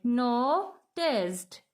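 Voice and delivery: NOTICED broken in two parts, no-ticed, with a pause between; split into syllables. Shown as a demonstration. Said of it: "दो टुकड़ों में".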